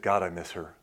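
low, soft, understated grief; subdued quote of a widower; quiet retold longing. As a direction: let it sink to a hushed confession. Retells widower missing his wife.

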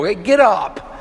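mock pep talk from a fellow man; rousing brotherly push. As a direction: fire a brother up to get going. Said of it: "men want men to go".

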